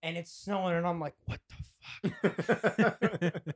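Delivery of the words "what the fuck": loud, long drawn-out dismay; raised, incredulous retold groan; appalled disbelief. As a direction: stretch it out slowly in disbelief. Rushed out into snow hungover.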